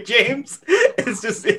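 high giggling through words; laughing. Real, not acted. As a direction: break up laughing. Giggling fit mid talk.

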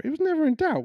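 it was never in doubt with a big high leap; emphatic never. Real, not acted. Preacher assuring listeners of the ending, high and emphatic. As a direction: lift the whole phrase high for emphasis. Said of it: "rhetorical emphasis".

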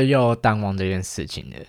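usual speaking voice

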